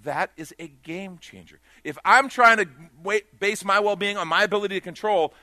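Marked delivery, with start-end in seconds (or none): 1.8-5.3 loud, driving preacher's call; forceful preaching. Acted. Preacher urging the congregation to decide, loud and driving. Preacher stresses point on control.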